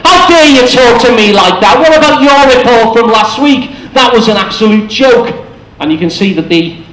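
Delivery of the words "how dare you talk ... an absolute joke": indignant, higher retort; mock outraged comeback; biting back. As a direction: snap back at the boss who insulted you. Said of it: "the victim will bite back".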